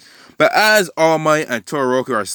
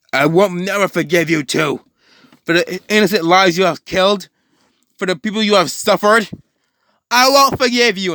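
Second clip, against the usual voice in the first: acted vow, pitch climbing steadily higher; righteous outrage; 7 semitones higher, 3 dB louder than usual. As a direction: build to a hammed-up outraged vow. Hero's vow to villains, acted.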